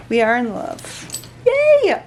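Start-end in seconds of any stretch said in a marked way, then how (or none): 1.5-2.0 high, loud cheer; squeal. Real, not acted. Cheering over the new bag, loud squeal. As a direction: squeal with delight.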